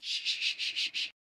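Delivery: unvoiced soft shh shh; whispered shushing. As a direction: hush her like silencing a child. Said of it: "Alex is gonna touch your lips like".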